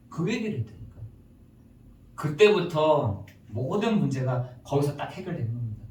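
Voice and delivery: ordinary delivery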